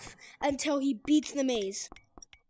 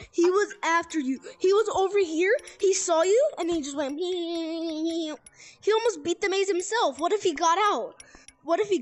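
louder, higher excited telling; 4 semitones higher, 5 dB louder than usual. Real, not acted. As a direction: rush it out, thrilled. Excitedly recounting chase.

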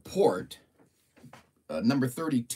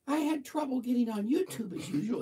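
much higher, pitched-up reading voice; high voice guess; 12 semitones higher than usual. Shown as a demonstration. Host guessing how Robert's voice sounds, in a high voice. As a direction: try the line way up high. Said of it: "if his voice is deep or high or medium".

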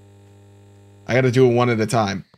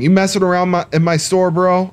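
mock confrontation; 6 semitones higher, 4 dB louder than usual. Confronts customer in his store.

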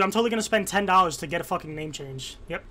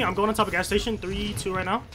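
usual speaking voice

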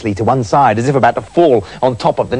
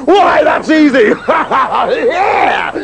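loud, high, dopey spirit; loud stupid voice; 11 semitones higher, 4 dB louder, much slower than usual. A narrator's reading. Spirit falling for the trick, loud dopey boast. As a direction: boast like a dim brute. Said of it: "in a loud, stupid voice".

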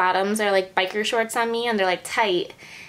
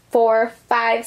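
ordinary talk, nothing marked

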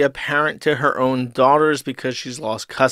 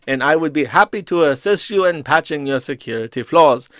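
mock Indian accent, raised pitch; 3 semitones higher than usual. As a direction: scam caller in Indian accent. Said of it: "My Indian accent's getting very good".

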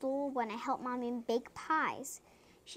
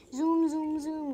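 loud, higher zooms; zooming plane; 4 semitones higher, 7 dB louder, slower than usual. A narrator's reading. Child reading plane in the sky, loud zooming. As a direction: imitate a plane zooming by, loudly. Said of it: "goes the plane in the sky".

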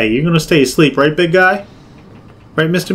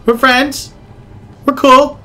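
high little reassurances; high-pitched peace offering; nervous placating; 8 semitones higher, slower than usual. Real, not acted. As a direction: appease the threat in a high voice. Placates the monster.

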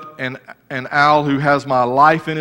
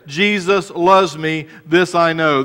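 slow proclamation; 4 semitones higher, 6 dB louder, slower than usual. Acted. Loud slow sung-phrase quote.

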